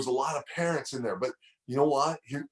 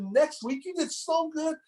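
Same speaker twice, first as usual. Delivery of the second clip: very high, eager announcement; dad teasing a surprise for his son, high and excited; slower than usual. Real, not acted. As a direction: build up to the surprise.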